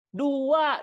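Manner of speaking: high, loud Dua in two beats as du-a; counting aloud. Shown as a demonstration. Teacher counting in Indonesian for parents, loud and high. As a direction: call out Dua in two clear syllables. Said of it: "Tapi begitu kita ngitung bahasa Indonesia, coba".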